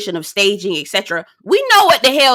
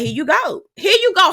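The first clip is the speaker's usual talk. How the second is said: exasperated; 9 semitones higher than usual. Retold or imitated. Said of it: "I'm like".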